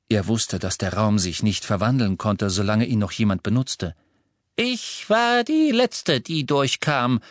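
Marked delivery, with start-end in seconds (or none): 4.5-7.2 put-on high, slow elderly lady voice; a firm old witch reporting; brisk old lady. A narrator's reading. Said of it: "sagte Misses Longbottom".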